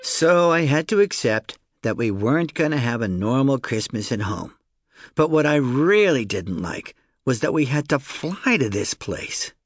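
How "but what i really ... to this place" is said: ordinary delivery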